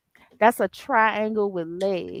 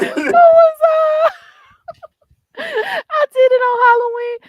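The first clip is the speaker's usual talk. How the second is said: laughing shriek; 9 dB louder than usual. Real, not acted. Laughing shriek over game.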